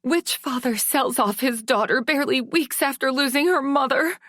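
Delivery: high, fast, furious disbelief; a daughter's shrill outrage at being sold; incredulous anger. Acted. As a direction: hurl it at your father. Daughter confronts her father.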